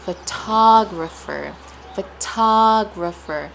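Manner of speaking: "Photographer" slowly as pho-TA-grapher; deliberate second-syllable stress on "photographer". Shown as a demonstration. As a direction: stretch TA in "photographer". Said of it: "The stress is placed on ta".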